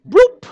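high, loud popping "bloop"; comic bloop sound. Retold or imitated. Preacher making the sound of a chick booted from the nest, loud and high. Mama bird pushes chick out.

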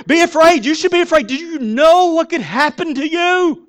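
very loud, high-pitched yell; screaming fear. Acted. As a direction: fear personified, screaming a threat at the listener. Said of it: "I'm gonna get a little loud".

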